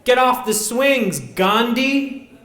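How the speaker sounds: high, loud playground taunt; bully's yell. Retold or imitated. Kid yelling at the fat boy on the swings, loud mocking taunt. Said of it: "he went".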